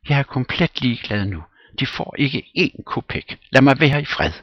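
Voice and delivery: high, loud and fast; rushed, shrill dismissal; Popova exasperated. Acted. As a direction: throw him off, fed up.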